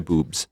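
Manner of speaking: "boobs" with long vowel and voiced BZ; native voiced ending. Shown as a demonstration. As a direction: buzz the end of "boobs". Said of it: "Ale oni říkají". (anglické „boobs“ se znělým bz)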